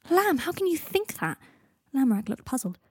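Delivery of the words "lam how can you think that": spluttering. A narrator's reading. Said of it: "Harry spluttered".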